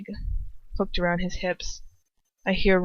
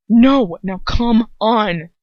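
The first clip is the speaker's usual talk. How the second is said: ordinary delivery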